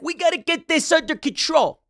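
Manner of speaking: high mocking impression; imitating Michael Rapaport. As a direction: do his voice, high and pushy. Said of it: "I'm trying to imitate him".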